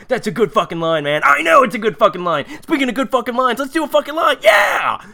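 mock self-congratulation, climbing into a yelled cheer; hyped-up writers. Writers patting each other on the back, mock hype. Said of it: "the writers' room right now, patting each other".

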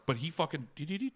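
ordinary delivery

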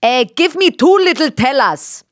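Bavarian dad. Retold or imitated. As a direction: imitate a Bavarian father ordering food. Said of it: "and my dad said".

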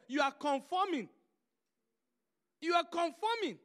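loud, rising preached rebuke; loud, forceful pulpit rebuke; preaching at full force. Real, not acted. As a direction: drive the rebuke home to the congregation. Preaching rebuke on ripped jeans.

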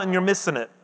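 sudden soft drop, almost to himself; dropped quiet. Acted. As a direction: let it fall away quietly. Preacher repeats the warning.

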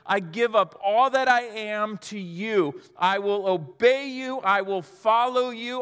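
fervent pledge. Acted. Thomas surrendering to Jesus as master, fervent. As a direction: vow it loudly, each phrase driven home.

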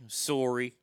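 Canadian "Sorry", said SORE-ee with a rounded O; Canadian accent. Pretend. Host nodding to Canadian fans, Canadian-accented "Sorry". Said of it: "That's for all our Canadian fans".

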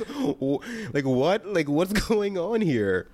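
confused, drawn-out what's going on; mock bewildered, slowed down; baffled viewer. Viewer baffled by rude subtitles, slow mock confusion. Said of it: "you're just like".

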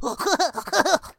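high, loud fake chortle; hammed-up nerdy guffaw.

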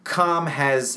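ordinary delivery

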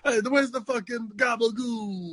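put-on high, laughing Italian-American accent. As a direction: ham up an Italian-American tough guy accent. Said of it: "My Italian accent".

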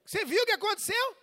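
high, loud, rapid question; acted angry confrontation. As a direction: demand an answer, fast and loud. Replays angry confrontation with wife.

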